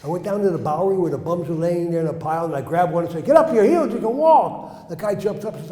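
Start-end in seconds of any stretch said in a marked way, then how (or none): 3.3-4.9 loud, high faith-healer shout; booming revival healer cry; yelled healing command. Retold or imitated. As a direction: proclaim it like a street healer. Healer yelling at bums.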